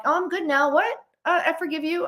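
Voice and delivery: high, breezy mock innocence; breezy forgiveness. Speaker acts out forgiving after lashing out, high and breezy. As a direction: lift it high, as if the anger never happened.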